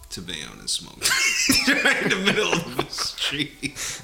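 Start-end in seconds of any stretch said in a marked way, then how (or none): 1.1-1.8 sudden squeaky cackle; a high, sharp burst of laughter. Real, not acted. Laughs at own Bayonne joke. 1.8-4.0 slow, laughing through words. Real, not acted. Laughs at own joke.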